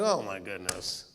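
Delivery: amused sigh. Retold or imitated. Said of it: "she was probably laughing, going".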